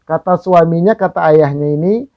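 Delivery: ordinary delivery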